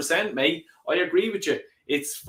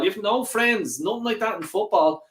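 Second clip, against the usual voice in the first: ordinary delivery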